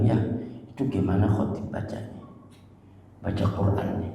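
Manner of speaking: quoted santri, low and soft; a student's hushed complaint about the preacher. Retold or imitated. Student quietly mocking the khotib's Quran reading, low and soft. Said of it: "Dia bilang".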